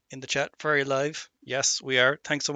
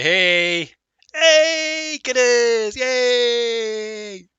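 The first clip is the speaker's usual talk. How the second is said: high, drawn-out cheering; loud sustained "hey" and "yay"; long cheer; 7 semitones higher, 5 dB louder, much slower than usual. Real, not acted. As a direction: cheer the Guinness, holding each word. Cheering his drink on camera.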